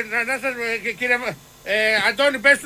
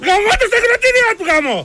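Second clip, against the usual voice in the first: higher, furious swearing outburst; angry outburst; 7 semitones higher than usual. Acted. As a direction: blow up at the caller over the dryer. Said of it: "ηρέμησε λες τώρα".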